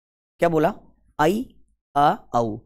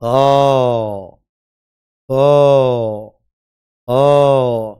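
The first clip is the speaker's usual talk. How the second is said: मज़ाकिया विरह की पुकार; 4 semitones lower, 7 dB louder, much slower than usual. Acted. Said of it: "एक दूसरे से दूर जाएंगे तो दुख तो होगा".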